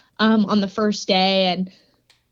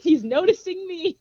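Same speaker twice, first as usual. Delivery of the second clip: higher, giddy hopeful inner voice; giddy thought; 6 semitones higher than usual. Retold or imitated. Thinking aloud about the crush, giddy and higher. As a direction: squeal it like a smitten fan.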